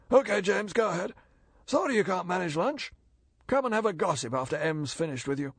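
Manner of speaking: casual send-off to a colleague; lightly voiced Chief of Staff. A narrator's reading.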